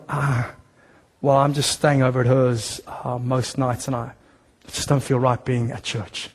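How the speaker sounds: a straying member owning up quietly; sheepish excuse. Retold or imitated. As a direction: mumble it like a guilty church member.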